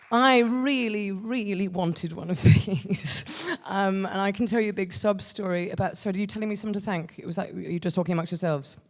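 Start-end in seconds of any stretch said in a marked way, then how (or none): none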